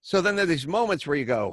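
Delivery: normal talking voice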